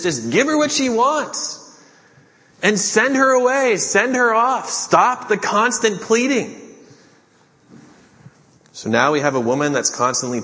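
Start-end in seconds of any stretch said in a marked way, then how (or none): 0.0-6.6 much higher, exasperated pleading to be rid of her; whiny, fed-up disciples begging Jesus; annoyed begging. Acted. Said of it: "they start begging Jesus".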